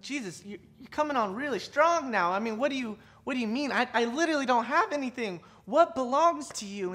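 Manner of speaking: acted bewilderment, rising in volume and pitch; flustered protest. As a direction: protest as if wrongly accused. Acting out flustered reply to Jesus.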